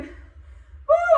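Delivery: loud high whoop of effort; excited whoop. Real, not acted. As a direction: let out a big cheering woo. Coach cheers mid-exercise.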